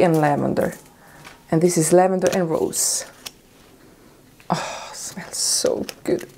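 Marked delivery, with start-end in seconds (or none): 4.5-6.3 soft slow whisper; whispered delight. Real, not acted. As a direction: breathe it out in awe. Sniffing lavender product.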